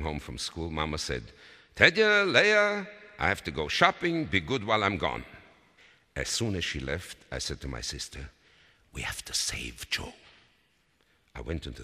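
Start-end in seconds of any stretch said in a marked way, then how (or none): 1.7-5.2 put-on high, loud call to the children; raised motherly voice. A narrator's reading. Mother calls children before leaving. 8.9-10.1 conspiratorial whisper to a sister; hushed, whispered plotting; secret whisper. A narrator's reading. Secret plan told to sister.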